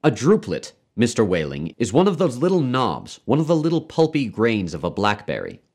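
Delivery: high, emphatic explanation; stern doctor spelling it out; severe lecturing. A narrator's reading. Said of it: "became rather severe with Wailing".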